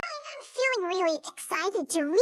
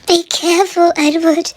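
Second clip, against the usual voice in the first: much louder cautioning coaches' voice; loud coaches; 15 dB louder than usual. Acted. Coaches loudly warning Edward as he comes near. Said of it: "said the coaches".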